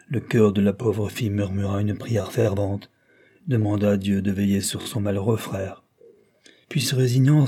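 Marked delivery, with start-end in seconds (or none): none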